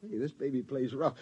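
normal talking voice

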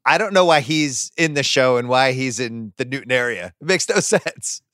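normal talking voice

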